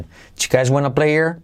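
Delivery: "here" as ear, quickly linked to "play"; fast choo guys wanna play-ear; omitted H. Shown as a demonstration. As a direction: drop the H of "here" like a native. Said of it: "la omiten".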